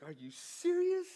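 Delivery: very high, drawn-out disbelief; incredulous. Retold or imitated. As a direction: squeak it out slowly in outraged disbelief. Acted disbelief at coach's verdict.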